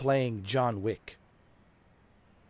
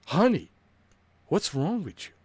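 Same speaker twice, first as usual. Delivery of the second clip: put-on girlfriend voice; 6 semitones higher, 3 dB louder than usual. Girlfriend mimicked.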